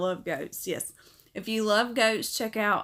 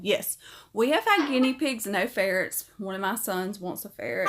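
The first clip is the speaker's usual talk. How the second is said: normal talking voice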